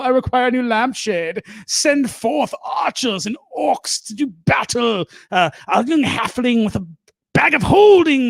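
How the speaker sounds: high, grandiose castle ruler; hammed-up medieval proclamation, pitched high; mock fantasy lord. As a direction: command your armies like a pompous lord.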